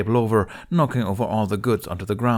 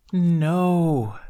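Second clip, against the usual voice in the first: long stretched high no; drawn-out no; 9 semitones higher, 5 dB louder than usual. A narrator's reading. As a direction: draw the word out, waving it off. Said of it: "Tooth drew out the word".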